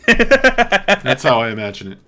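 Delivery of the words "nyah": loud, high mocking nyah; sing-song taunting. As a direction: jeer back even louder. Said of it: "Bloody Booger's like".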